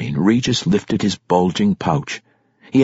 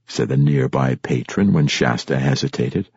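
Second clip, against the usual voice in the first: ordinary delivery, nothing marked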